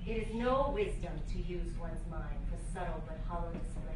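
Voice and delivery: low soft solemn mentor; solemn elder. Acted. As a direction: pronounce wisdom like an old sage. Elder gives final wise rule.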